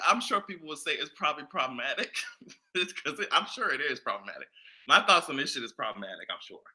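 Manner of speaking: soft, fast mumble to herself; muttered aside. Real, not acted. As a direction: mumble the concession under your breath. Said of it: "softly conceding, mumbled aside".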